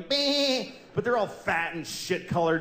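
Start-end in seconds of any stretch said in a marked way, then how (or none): 0.0-0.7 high little sheep bleat; lightly voiced bleating sheep. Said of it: "sheep going".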